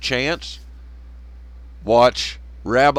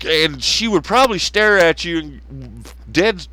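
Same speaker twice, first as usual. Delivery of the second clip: usual speaking voice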